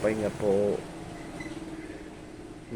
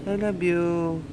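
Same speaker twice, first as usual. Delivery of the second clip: lightly raised sing-song voice for a pet; 5 semitones higher than usual. Real, not acted. Owner cooing to her dog Sweetie, in sing-song baby talk.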